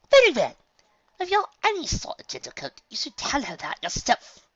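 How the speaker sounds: very high, loud firm lady; haughty elegant mare; Rarity voice. Acted. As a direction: close the matter with authority. Rarity decrees.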